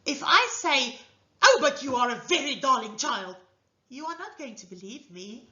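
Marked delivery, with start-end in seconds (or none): 1.4-3.4 harsh, loud delivery of a compliment; tone contradicting the words. Shown as a demonstration. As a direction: say sweet words in a hard, forceful tone. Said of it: "my tone of voice and the words".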